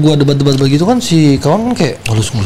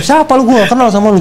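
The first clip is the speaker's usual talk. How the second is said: high-pitched heated challenge; retold angry challenge; 10 semitones higher than usual. Challenging the boyfriend on the call, heated. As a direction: square up to him with your voice. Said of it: "gua bilang".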